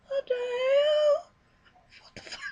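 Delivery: high, stretched-out shocked cry of disbelief; shocked outburst. Retold or imitated. Reacting to the movie scene, high drawn-out shocked cry.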